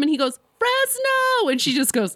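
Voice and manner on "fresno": very high, eager yell; fan shouting answer. Retold or imitated. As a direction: shout your hometown proudly. Fresno guy shouting from the crowd.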